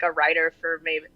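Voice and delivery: ordinary delivery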